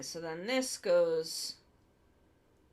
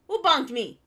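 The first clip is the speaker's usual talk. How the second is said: startled, louder and higher; jolted out of focus, louder; 5 semitones higher, 13 dB louder than usual. Real, not acted. Startled by being bonked.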